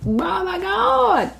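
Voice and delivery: high, slow, thrilled "Oh my God"; excited gasp. Real, not acted. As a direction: let the thrill burst out, stretching it. Excited about next year.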